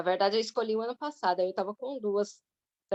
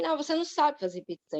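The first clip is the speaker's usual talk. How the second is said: higher, quick imitation of a dismissive boss; retold contradiction, rushed and pitched up; mock verbal attack; 6 semitones higher, faster than usual. Arrogant lawyer contradicting the job candidate, retold verbal attack. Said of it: "agredir verbalmente".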